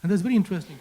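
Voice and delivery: ordinary delivery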